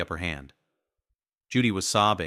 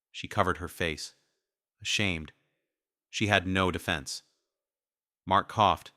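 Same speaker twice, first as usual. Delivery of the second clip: ordinary delivery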